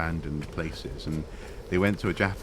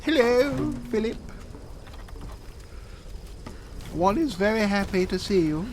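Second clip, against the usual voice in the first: very high-pitched royal impression; hammed-up received pronunciation, pitched way up; the Queen. Monarch greeting Philip, mock-regal high voice. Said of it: "like, the Queen".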